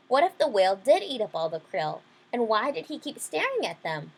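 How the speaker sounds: ordinary delivery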